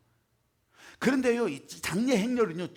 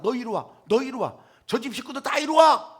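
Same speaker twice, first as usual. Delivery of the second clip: louder, higher stern summons; mock scolding; 4 semitones higher, 7 dB louder than usual. Imagined God summoning sinners, mock scolding. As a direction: call them in to punish. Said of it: "이렇게 혼내지 않으셨어요".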